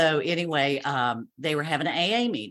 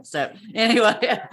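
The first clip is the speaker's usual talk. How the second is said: laughing; 8 semitones higher, 7 dB louder than usual. Real, not acted. Laughing at her own story.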